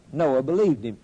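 ordinary talk, nothing marked

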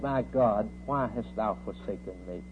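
understated cry. Retold or imitated. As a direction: quote the anguished cry low and restrained. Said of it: "He cried".

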